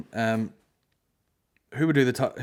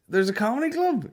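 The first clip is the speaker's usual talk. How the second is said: mocking the bar guy; 12 semitones higher than usual. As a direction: ham up a nosy punter spotting a comic. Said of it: "this guy's like".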